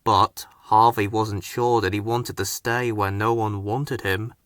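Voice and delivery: usual speaking voice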